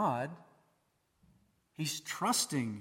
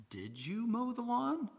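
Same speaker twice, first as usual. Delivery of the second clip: high, doubting, half-soft question; skeptical question; 7 semitones higher, 6 dB quieter than usual. Shown as a demonstration. Preacher showing a parent's skeptical question. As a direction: let the doubt ride up in the voice. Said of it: "hear the skepticism in a person's voice".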